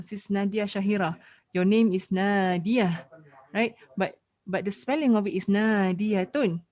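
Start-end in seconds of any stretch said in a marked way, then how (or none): 2.1-3.1 "Nadia" drawn out slowly, no -tun ending; muted last letter. Shown as a demonstration. Teacher showing a student their name, ending muted. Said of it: "you do not pronounce the last huruf". 5.5-6.6 "Nadiatun" with the -tun ending sounded; full original form. Shown as a demonstration. Teacher spelling out a student's name, full -tun ending. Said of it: "you do not pronounce it as Nadiatun".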